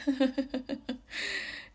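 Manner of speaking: high mock laugh; creepy laugh. Imitating the man's laugh before a threat. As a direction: imitate his eerie chuckle. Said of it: "He said".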